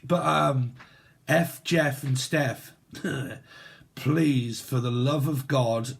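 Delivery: ordinary delivery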